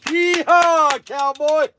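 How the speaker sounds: a high, whooping yeehaw cowboy. Pretend. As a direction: holler it like a rodeo cowboy. Cowboy pistol demo.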